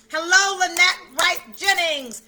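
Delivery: loud, slow, sing-song hello; excited greeting. Real, not acted. Host greeting a viewer in the chat, loud and drawn out. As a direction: stretch out the full name warmly.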